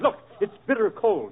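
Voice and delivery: ordinary talk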